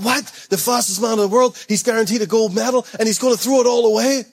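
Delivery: high-pitched incredulous outcry; freaking out. Acted. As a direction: act out a crowd in disbelief. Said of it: "people's freaking out".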